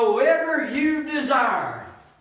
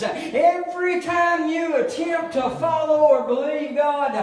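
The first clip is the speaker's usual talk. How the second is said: building preacher fervor; 6 semitones higher than usual. Real, not acted. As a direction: preach it hard against the devil. Preacher building to full force.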